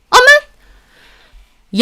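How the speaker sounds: high, loud startled yelp; startled cry. Acted. Woman yelps as bread is thrown down, startled. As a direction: recoil with a shriek of surprise.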